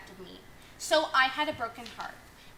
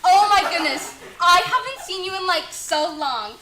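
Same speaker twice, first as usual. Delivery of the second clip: gushing; 4 semitones higher, 6 dB louder than usual. Acted.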